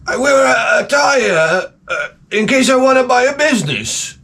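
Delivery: high, halting caricature voice; mocking impersonation. Man explaining his tie, mocking high imitation. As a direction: mimic him, strained and high-pitched with hesitations. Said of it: "he talks like this".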